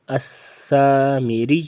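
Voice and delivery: اسامیری slow with a firm doubled y. Shown as a demonstration. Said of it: "زور سے پڑھیں گے". (اسامیری میں یا پر زور)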